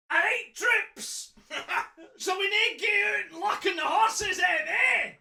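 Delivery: high, loud broad Fife voice; hammed-up Fife Scots, pitched right up; Fife accent. Reader playing the villain rallying his troops, broad Fife accent. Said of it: "Aye, then I can".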